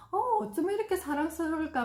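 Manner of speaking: high, doting gush to husband; gushing praise. Shown as a demonstration. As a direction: gush affectionately. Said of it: "우리 남편에게". (남편에게 하듯 감탄하며)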